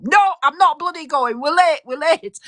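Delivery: high, huffy protest; mock stroppy refusal. Sister-in-law refusing her partner, mock huffy. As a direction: whine it like a sulking woman. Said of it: "to her partner".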